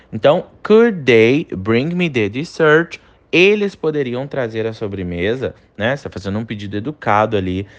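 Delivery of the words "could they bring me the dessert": slow, careful polite request; slow English model sentence. Shown as a demonstration. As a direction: model it again slowly. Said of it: "Penúltimo exemplo". (pedido educado em inglês, devagar)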